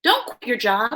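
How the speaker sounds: ordinary talk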